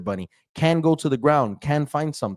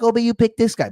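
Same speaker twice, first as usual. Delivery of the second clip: mocking complainer; 11 semitones higher, 5 dB louder than usual. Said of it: "people come back".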